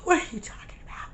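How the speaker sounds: rushed whispered disbelief; quick stunned whisper; fast whisper. Retold or imitated. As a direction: hiss it fast under your breath. Disbelief at the officer.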